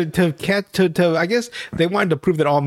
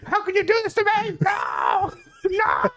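very high, strained mock scream; Keanu Reeves; 4 dB quieter than usual. Friend imitates the buried husband pleading, high mock scream. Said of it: "Keanu Reeves screaming".

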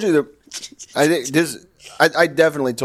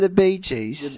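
mock British accent; 3 semitones higher, slower than usual. Mock British, after "They're British.

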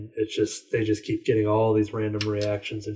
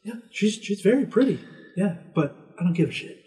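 high, put-on old man's voice; hammed-up old man waving off a pushy host; grumpy old man; 9 semitones higher than usual. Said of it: "And he's like".

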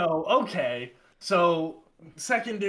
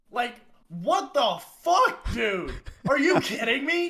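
outraged disbelief; 6 semitones higher than usual. Retold or imitated. Outraged at second pullover.

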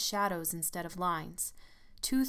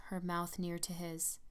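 usual speaking voice

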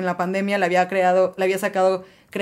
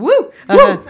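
high, loud whoop; excited cheer; 13 semitones higher, 10 dB louder, slower than usual. Real, not acted. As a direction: whoop it up with delight. Cheering the dad's movie role.

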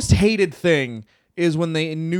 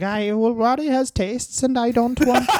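mock wife voice; 7 semitones higher than usual. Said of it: "who is just like".

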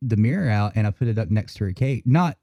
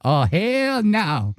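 slow, higher drawn-out refusal; sassy bird refusal; 7 semitones higher, much slower than usual. Retold or imitated. Voicing the bird snubbing the mirror, slow and sassy. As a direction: draw out the no like an offended bird. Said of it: "She was just like".

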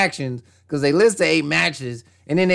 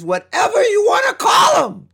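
dismissive outburst. Real, not acted. Dismissive rant about a team.

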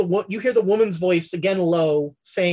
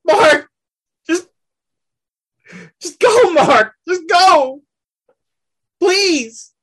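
high, loud pleading father voice; desperate father; 10 semitones higher, 6 dB louder than usual. Acted. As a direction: beg them to save your child.

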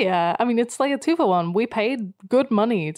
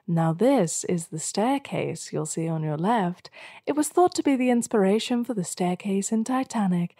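tour guide voice. Retold or imitated. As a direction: recite it like a museum guide. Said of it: "in that voice throughout".

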